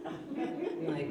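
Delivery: ordinary talk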